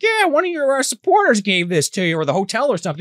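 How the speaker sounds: high, eager mock helper voice; imitating Peter. Peter handing over the flowers, high and eager. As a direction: pitch it up as a fawning helper.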